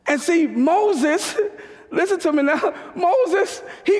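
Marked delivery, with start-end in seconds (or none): none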